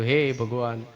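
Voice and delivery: pleading to God. Retold or imitated. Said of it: "રડી રડીને આપણે માલિકને કહીશું".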